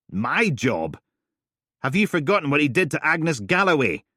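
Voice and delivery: loud and sharp, accusing; indignant. Acted. Steele rounding on Helen, loud and indignant. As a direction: jab each word at her.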